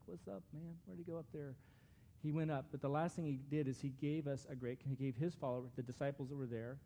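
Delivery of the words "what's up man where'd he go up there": higher, softer, quick mock bystander; puzzled onlookers, imitated. Preacher imitating disciples looking up after the ascension, lightly puzzled. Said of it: "standing there looking up there like".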